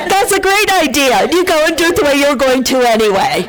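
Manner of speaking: laughing at the congregation's habit; laughing mock agreement. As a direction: laugh through the mock advice. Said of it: "you're all laughing".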